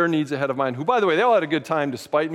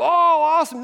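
high, loud mock enthusiasm; mock excitement; 11 semitones higher, 6 dB louder than usual. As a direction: gush like an envious listener. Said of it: "You might go".

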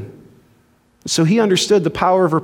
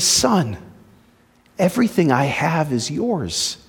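gentle father; 5 semitones lower, 3 dB quieter than usual. Retold or imitated. Father gently answers angry son.

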